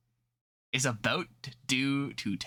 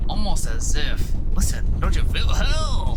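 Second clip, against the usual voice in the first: much louder, urgent warning; alarmed; 12 dB louder, slower than usual. Acted. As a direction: call out in alarm as the ground throbs. Panic over trembling ground.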